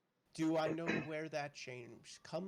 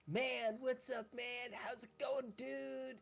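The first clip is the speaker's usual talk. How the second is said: higher-pitched dude greeting a buddy; dude-bro talk; 8 semitones higher, slower than usual. Acted. Imitating people who greet with "what's up, man", high-pitched dude voice. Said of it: "people who sound like".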